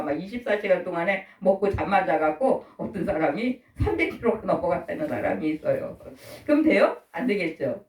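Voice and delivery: normal talking voice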